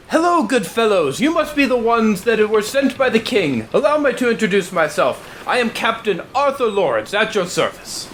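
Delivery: high, proper naval captain voice; posh captain. Acted. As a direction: play a prim, polite sea captain. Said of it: "And he says".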